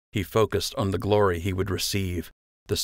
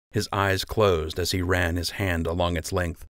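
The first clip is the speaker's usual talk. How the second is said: usual speaking voice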